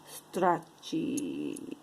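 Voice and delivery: "Stretchy" said with a Russian accent, sounding like "scratchy"; Russian-accented "Stretchy", low and drawn out; low Russian-accented "Stretchy" with a falling tune. Shown as a demonstration. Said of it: "I say it with the Russian accent".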